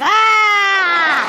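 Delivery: loud, sustained mock scream; imitated crowd scream. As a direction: let out one long drunken-crowd yell. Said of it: "just screaming and yelling".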